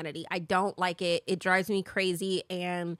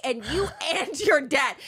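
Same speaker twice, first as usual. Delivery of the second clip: ordinary delivery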